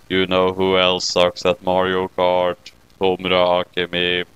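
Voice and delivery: a deep deadpan critter gloating; low smug creature saying I told you so. Acted. Kyubey voice.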